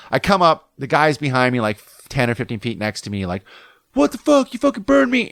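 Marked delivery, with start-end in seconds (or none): 3.9-5.3 imitating a furious surfer; angry yell. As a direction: yell it like you just got burned. Angry surfer yells at him.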